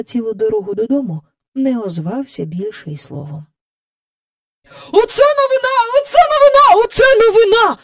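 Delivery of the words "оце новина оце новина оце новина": high, loud, repeated exclamations; excited exclaiming. A narrator's reading. Mister Banks running home with amazing news, excited shouting. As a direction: burst in bursting with news. Father rushes in with great news.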